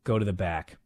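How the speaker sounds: low quiet warning to get backstage; understated stern referee order; stern ref. Retold or imitated. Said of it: "she goes".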